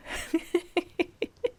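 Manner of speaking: a big high bright laugh; hearty laugh. Acted. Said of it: "Paulina laughed heartily".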